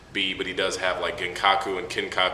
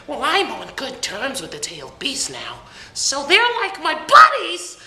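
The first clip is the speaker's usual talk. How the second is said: Naruto; 6 dB louder than usual. Retold or imitated. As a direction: squeal it proudly, building to a loud high finish. Voices Naruto's reply, excited.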